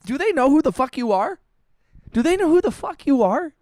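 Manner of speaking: high-pitched mock outrage on a friend's behalf; indignant outburst. Streamer outraged for a robbed friend, high-pitched indignation. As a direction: demand it indignantly, pitch jumping well up. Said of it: "actually angry right now".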